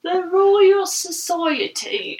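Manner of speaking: slow, high, hammed-up British accent; mock English accent. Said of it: "It makes me really cringe".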